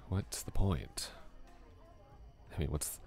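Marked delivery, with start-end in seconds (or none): none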